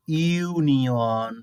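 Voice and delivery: ইউনিয়ন stretched out very slowly, "iu" clearly voiced; slow teacher model of ইউনিয়ন; slow demo pronunciation. Shown as a demonstration. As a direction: draw out ইউনিয়ন so students hear the "iu". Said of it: "ইউ এর উচ্চারণ ইউ হলো".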